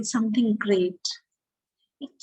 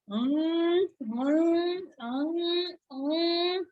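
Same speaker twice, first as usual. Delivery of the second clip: high contented munching mm sounds; munching hum; 5 semitones higher, much slower than usual. Acted. Storyteller voicing Gerald munching, a high hum. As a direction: hum like a giraffe chewing leaves.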